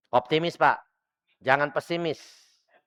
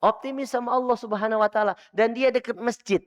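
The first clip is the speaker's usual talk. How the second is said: raised, high preaching voice; emphatic preaching; 10 semitones higher, 4 dB louder than usual. Real, not acted. Preacher urging the congregation to stay near the mosque, emphatic. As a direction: drive the message home to the congregation.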